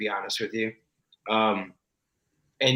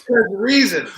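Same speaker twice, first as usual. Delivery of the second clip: slow, high, laughing disbelief; incredulous; 7 semitones higher, 5 dB louder, slower than usual. Retold or imitated. Actor questioning the director's idea, laughing disbelief. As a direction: stretch it out in amused disbelief. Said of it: "I'm like".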